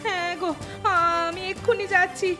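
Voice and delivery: high, tearful agreement; panicked. Acted. Wife rushing off for help for bitten husband, high and panicked. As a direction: answer high-pitched in distress.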